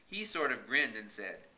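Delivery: ordinary delivery, nothing marked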